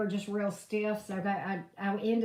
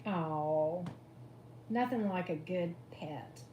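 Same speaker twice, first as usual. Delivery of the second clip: usual speaking voice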